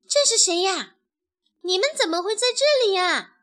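high, loud surprise; astonished question. A narrator's reading. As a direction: ask in amazement, voice jumping up. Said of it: "卡门吃惊地问".

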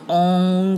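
on drawn out with a back nasal ending; on as "ong"; NG sound. Shown as a demonstration. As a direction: hold on into an ng. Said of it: "是N G发on的音".

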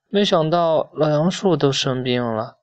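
plain reading voice

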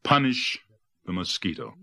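usual speaking voice

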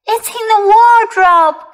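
put-on high, loud, alarmed child voice; frightened child. Kipper telling Mom where the monster hides, frightened child. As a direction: point out the danger in a high voice.

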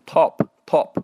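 British "top" with a rounded, small-mouth o; British accent. Shown as a demonstration. Teacher shows how the British read it, British accent. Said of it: "英国人可能会读".

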